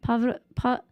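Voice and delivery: ordinary talk, nothing marked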